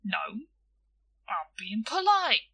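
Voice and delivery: testy, leaning on the last word; irritable. A narrator's reading. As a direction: insist with mild irritation. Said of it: "he said irritably".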